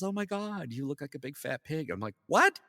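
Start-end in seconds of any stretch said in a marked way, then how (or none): 0.0-1.9 high, put-on woman's voice; imitated female co-worker. Co-worker spotting his weight gain, high mock dismay. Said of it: "Robin saw me, and she goes". 2.3-2.6 very high, loud yelp of disbelief; mock shocked. Hearing he looks fat, high mock shock. Offended reply to fat remark.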